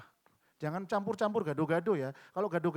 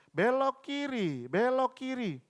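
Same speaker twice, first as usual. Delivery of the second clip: higher, louder, slow robotic GPS prompt; navigation voice; 8 semitones higher, 6 dB louder, much slower than usual. Retold or imitated. Preacher imitating Google Maps telling the driver to turn, higher and robotic. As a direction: mimic the map app's instruction. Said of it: "Lalu Google Map".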